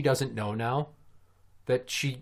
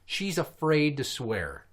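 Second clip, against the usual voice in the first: normal talking voice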